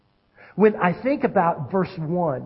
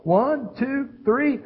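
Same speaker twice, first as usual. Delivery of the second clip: raised-pitch countdown to three; parent's warning count; 8 semitones higher, much slower than usual. Acted. Parent counting down at the kids, warning. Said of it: "the whole".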